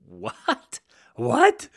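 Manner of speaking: sharp, rising "What? What?", the second shooting way up and loud; imitating an astonished man who can't believe his luck; stunned disbelief. Grateful man hearing his roof will be fixed, stunned. Amazed man retold with surprise.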